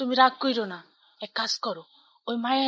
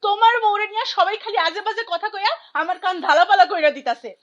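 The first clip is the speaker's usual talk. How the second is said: high-pitched, louder whining outrage; angry complaint; 6 semitones higher, 3 dB louder than usual. Acted. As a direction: complain bitterly to your mother. Daughter's angry complaint to mother. (রাগে চড়া গলায় নালিশ)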